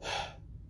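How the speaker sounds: long breathy exasperated sigh; weary sigh. Real, not acted. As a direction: let out a tired fed-up sigh. Fed-up sigh mid rant.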